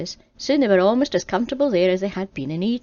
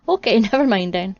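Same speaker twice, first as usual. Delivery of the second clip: nervous laugh, louder; laughing retreat; 6 dB louder than usual. Acted. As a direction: back off with a laugh. Adam backs off.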